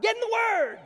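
loud high bark; sudden high shout; shouted command. Retold or imitated. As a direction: yell it at the fleeing kid. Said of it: "She's like".

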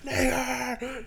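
high strained scream, held back in volume; mock scream. Podcaster retelling a lawmaker's yell, mock scream. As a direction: imitate a scream without full volume. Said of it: "Just fucking screaming".

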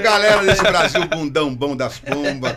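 loud drawn-out hello that settles into patter; showman's greeting. Real, not acted. Guest greets the audience after his introduction, showman's shout. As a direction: open big like a variety-show entertainer.

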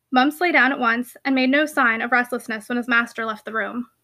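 ordinary delivery

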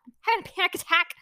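frantic. Retold or imitated.

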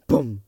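short loud "boom" sound effect; punchy vocal boom; spoken explosion noise. Pretend. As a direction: imitate a blast in one burst. Makes own sound effect.

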